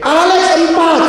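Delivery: high, excited mock shout; hammed-up shouted announcement; imitated friend's yell. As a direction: yell it out like an astonished classmate. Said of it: "Terus dia teriak-teriak".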